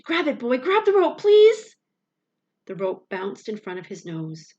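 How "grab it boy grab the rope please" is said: rising to a much higher, louder pleading scream; desperate shriek. A narrator's reading. Boy begging his dog to grab the rope, shrieking. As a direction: beg with all your breath. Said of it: "I shrieked".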